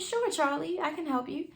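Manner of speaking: sweet mother reply. Retold or imitated. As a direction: gently reassure a seven-year-old.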